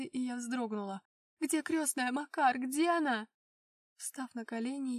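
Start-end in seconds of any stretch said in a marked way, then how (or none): 1.4-3.3 alarmed, raised voice calling out; frightened cry. Acted. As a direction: panic rising in the question. Heroine panics, searching for godmother. (испуганный крик)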